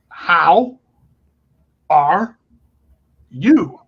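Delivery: word-by-word, with long pauses; mock condescending. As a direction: imitate someone talking down, one loud word at a time. Second lady talks down to him.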